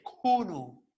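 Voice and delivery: "kunu" with breath after the K; aspirated K. Shown as a demonstration. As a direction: puff through the K of "kunu". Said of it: "no es simple".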